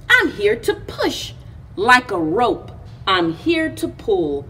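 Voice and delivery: ordinary delivery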